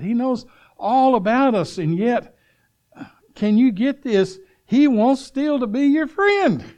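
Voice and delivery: a revival preacher pressing the point home; fervent preaching. Acted. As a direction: preach it out to the whole church, with fire. Preacher builds to fervent peak.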